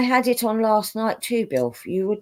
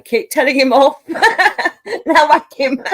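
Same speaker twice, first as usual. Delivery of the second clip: higher and louder through laughter; laughing; 7 semitones higher, 6 dB louder than usual. Real, not acted. Egging a friend on from the chat, laughing. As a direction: laugh the words out.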